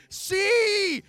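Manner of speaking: high, loud, drawn-out yell; fervent shout. Pretend. Fan explaining the poetry, fervent shout. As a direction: burst out with sudden conviction.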